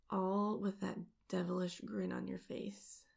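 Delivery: plain reading voice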